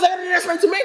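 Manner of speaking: mock proud announcement; Joe's big announcement. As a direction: puff up like a man with big news. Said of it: "Joe goes".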